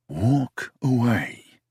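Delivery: self-command. Acted. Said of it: "I told myself again".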